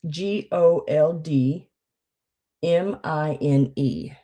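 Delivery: slow letter-by-letter spelling; spelled slowly. Shown as a demonstration. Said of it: "spelling letters slowly".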